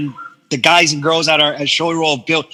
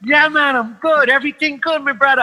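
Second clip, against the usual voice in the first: cheerful greeting; 7 semitones higher than usual. Retold or imitated. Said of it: "And I was like".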